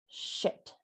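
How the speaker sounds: under-the-breath swear; hushed startled curse; whispered curse. Pretend. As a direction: mutter it as you slip. Slipping on soap.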